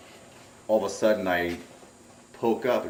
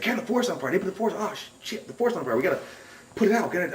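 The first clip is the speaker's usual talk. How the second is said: re-enacted alarm, pitched way up; panicked fire warning; 11 semitones higher, faster than usual. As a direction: blurt it high and frantic, as if just jolted awake. Re-enacts waking friend about fire.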